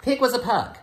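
put-on bright, high read-aloud to kids; storybook reading. Teacher reading to her class, lively storybook voice. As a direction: read it like a picture book for first graders.

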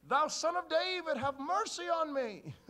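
loud high drawn-out plea; crying from afar. Acted. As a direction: call out from the distance, slow and loud. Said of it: "they hear from the distance".

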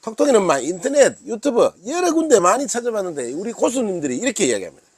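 usual speaking voice

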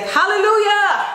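joyful churchy shout, way up high; church voice. Pretend. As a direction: belt it like a gospel preacher. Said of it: "my church voice".

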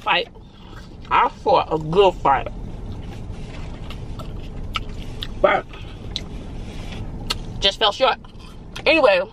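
talking while chewing; speaking between bites; mouth full of fries. Real, not acted. Seen 1: Puts fries in mouth at 1.7 and 9.7, talks while chewing.

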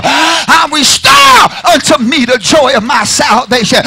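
loud, slow, stretched whoop. Acted. Pastor whooping the psalm back to the church. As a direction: draw it out loud and high.

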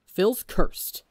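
sharp, loud snap; impatient snap. A narrator's reading. Technoblade exposing Phil to the crew, sharp snap. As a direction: cut him off hard. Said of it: "Technoblade snaps impatiently".

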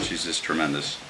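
ordinary talk

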